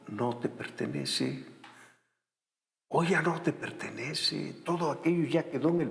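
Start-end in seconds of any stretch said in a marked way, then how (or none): 0.1-4.6 soft emphasis. Real, not acted. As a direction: let it sink in gently. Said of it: "emphatic soft repeat".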